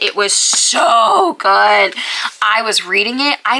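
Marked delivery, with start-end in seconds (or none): 0.0-1.9 cry-shout gush. Real, not acted.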